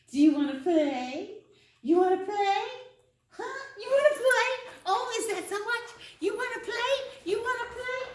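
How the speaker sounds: rising excited dog-talk; building excited invite. Shown as a demonstration. Trainer winding her dog up to play tug, excited. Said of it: "I always start a tug game with Ben by going".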